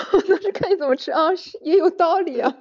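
high, loud laughing speech. Real, not acted. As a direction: talk through real laughter.

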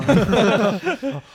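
sudden high, loud laugh; real cackle of amusement; hearty laugh. Real, not acted. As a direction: burst out laughing. Bursts out laughing at story.

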